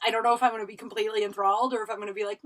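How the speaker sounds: normal talking voice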